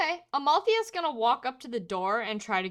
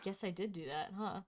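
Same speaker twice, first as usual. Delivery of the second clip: much softer, caught-out admission; sheepish; 3 semitones lower, 13 dB quieter than usual. Acted. Amalthea admits to the men, soft and sheepish. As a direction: quietly own up to it.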